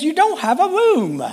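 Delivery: high-pitched mock British accent. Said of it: "that's my best British accent".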